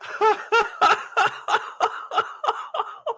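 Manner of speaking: wheezing high laugh; high helpless laughter. Real, not acted. Vlogger dying laughing at the clip, high wheeze. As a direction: laugh until out of breath.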